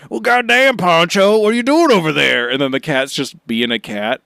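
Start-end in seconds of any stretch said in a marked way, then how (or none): 0.1-2.5 Stone Cold impression. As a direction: ham up a rowdy Texan cat dad. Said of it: "he's be like".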